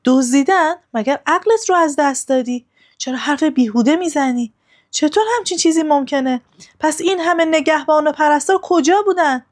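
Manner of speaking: narrated queen's outrage, lightly acted; angry questioning. A narrator's reading. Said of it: "با عصبانیت پرسید".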